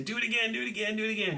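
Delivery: high, pleading chant of do it again; eager spectators. Retold or imitated. Spectators begging the magician to repeat, high eager chant. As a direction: beg like a hooked audience. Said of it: "They're gonna say".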